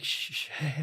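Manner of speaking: hushed whispered shushing; soft urgent whisper in the dark. Retold or imitated. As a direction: shush the kids while sneaking. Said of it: "sneaking, trying to be quiet".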